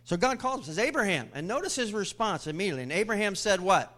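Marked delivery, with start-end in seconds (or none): 0.8-1.3 high, loud call; calling out a name. Acted. God calls Abraham by name, high calling voice. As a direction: call out to summon him.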